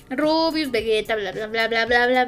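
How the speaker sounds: normal talking voice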